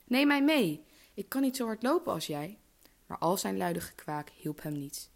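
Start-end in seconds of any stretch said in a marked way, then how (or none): 0.1-2.6 high-pitched pleading call. A narrator's reading. Frog left behind by the running princess, calling out. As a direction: plead loudly, starting strong then easing off. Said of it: "riep de kikker".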